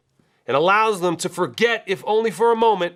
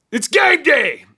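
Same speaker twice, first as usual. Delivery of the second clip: high, loud game-day holler; excited shout; 9 semitones higher, 6 dB louder, slower than usual. Real, not acted. Host firing up fans on game day, excited shout. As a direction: belt it out like a hype man.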